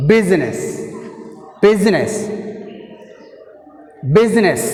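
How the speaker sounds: "business" with a firm first syllable and falling end; teacher modelling "business", stressed at the start. Shown as a demonstration. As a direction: hit the BUS of "business", let the rest drop. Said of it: "first syllable".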